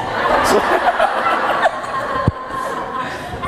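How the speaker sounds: airy laughter; high unvoiced laugh. Real, not acted. Breathless laugh at his own funny story.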